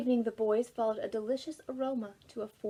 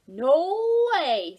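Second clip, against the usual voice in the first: loud, higher "no way"; loud refusal; 6 semitones higher, 10 dB louder than usual. A narrator's reading. Jose to Ruby, a loud, higher refusal. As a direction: shout her down.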